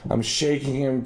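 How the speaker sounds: normal talking voice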